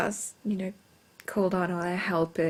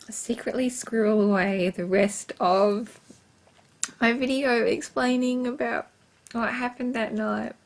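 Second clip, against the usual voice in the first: ordinary delivery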